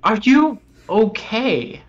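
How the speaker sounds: ordinary talk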